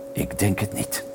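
low, soft mumble as the man; muttering. A narrator's reading. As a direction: mutter it doubtfully under your breath. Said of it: "mompelde de man". (zacht, binnensmonds gemompel)

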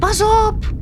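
very high cry of alarm; shouted warning. A narrator's reading. Little crab warning the reef creatures of danger, shouting high. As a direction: shout a warning at the top of your range. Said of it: "schreeuwde Klaartje".

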